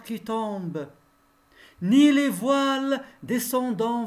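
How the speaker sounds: measured classroom recitation of a poem. Acted. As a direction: recite solemnly, lifting the openings of the verses. Recites the whole poem again.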